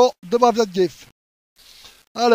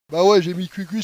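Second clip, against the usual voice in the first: usual speaking voice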